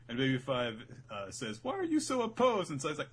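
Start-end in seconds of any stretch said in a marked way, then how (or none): 1.6-2.7 put-on girl's voice. Baby Five asking.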